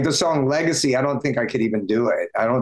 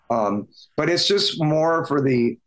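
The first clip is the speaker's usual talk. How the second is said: ordinary talk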